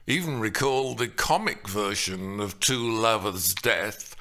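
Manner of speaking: normal talking voice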